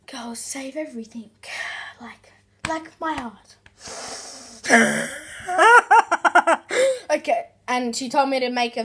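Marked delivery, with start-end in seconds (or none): 0.1-3.6 soft unvoiced whisper. Real, not acted. Whispered cheesy joke. 3.8-7.0 loud laugh. Real, not acted. Laughs at own joke.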